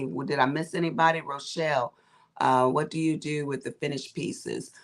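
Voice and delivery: normal talking voice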